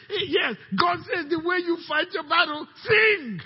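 rising to a shout on sing; full-force preacher cry; preaching shout. Real, not acted. Preaching climax.

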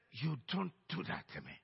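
low, restrained anger; angry warning. Retold or imitated. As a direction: warn them off, low and soft. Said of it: "and I was angry".

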